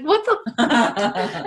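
stunned, laughing at his confession; laughing disbelief. Retold or imitated. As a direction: stretch out the shock with a laugh. Shocked by the bouncer's confession.

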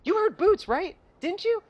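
put-on high, eager childlike pleading; eager Dora. Imitating Dora asking the kids, high and eager. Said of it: "she's just like".

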